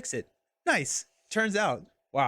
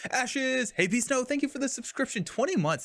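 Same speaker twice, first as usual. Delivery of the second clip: excited thanks; 10 semitones higher than usual. Real, not acted. Streamer thanking a subscriber of twenty months, excited. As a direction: greet a loyal supporter with lifted pitch.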